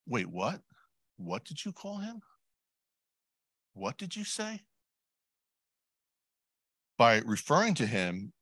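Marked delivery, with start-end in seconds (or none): 0.0-4.6 high, softer, incredulous questioning; quiet high-pitched disbelief; shocked Pharisee. Pretend. Pharisee challenging the blind men's title for Jesus, incredulous. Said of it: "Imagine the Pharisee saying".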